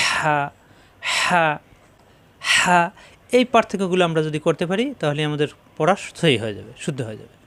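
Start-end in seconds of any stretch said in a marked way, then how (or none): 0.1-2.8 হা with a hissing rush of air from a squeezed mid-throat; rasping pressed ha. Shown as a demonstration. Teacher demonstrating the heavy ha, হা pressed with rasping air. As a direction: squeeze the throat and rasp breath through হা. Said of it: "ফ্যাস ফ্যাস আওয়াজ হবে".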